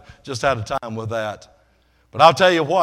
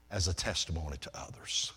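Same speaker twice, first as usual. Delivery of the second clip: whisper; 8 semitones lower, 13 dB quieter than usual. Acted. Whispered close of the point.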